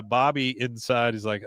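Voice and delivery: ordinary talk, nothing marked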